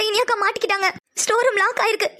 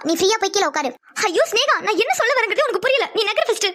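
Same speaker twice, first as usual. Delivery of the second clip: normal talking voice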